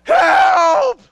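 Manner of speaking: high, loud desperate shout; screaming for help. Retold or imitated. Cramped student on the floor calling friends, full shout. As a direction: yell for your friends across the house. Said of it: "I start screaming to my friends".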